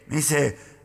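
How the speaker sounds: ordinary delivery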